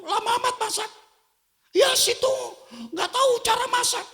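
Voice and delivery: high, loud mock quarrel; hammed-up snapping at each other; husband and wife trading jabs over cooking; bickering couple.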